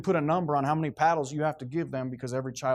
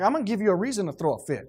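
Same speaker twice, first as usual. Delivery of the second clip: threatening parent; 6 semitones higher, 4 dB louder, faster than usual. Retold or imitated. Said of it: "I'm like".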